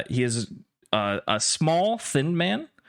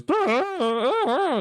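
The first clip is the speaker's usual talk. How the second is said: much higher strained pokemon yelp; creature scream; much slower than usual. Acted. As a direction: squeal like a creature being yanked through a fence. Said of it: "And Edward goes".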